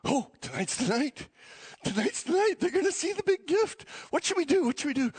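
giddy, high-pitched anticipation; playing excited God. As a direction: act out God too eager to wait. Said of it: "playing excited God".

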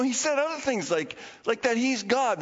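ordinary talk, nothing marked

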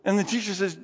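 ordinary talk, nothing marked

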